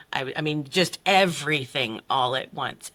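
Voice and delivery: ordinary talk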